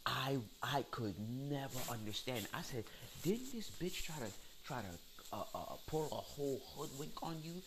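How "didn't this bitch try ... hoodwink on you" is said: high-pitched retold disbelief; incredulous challenge. Retells his incredulous challenge to her.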